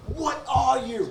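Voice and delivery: high, slow, scornful chef voice; impression of Gordon Ramsay. Friend doing a celebrity chef mocking a contestant, scornful. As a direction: sneer it down at the victim. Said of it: "Gordon Ramsay".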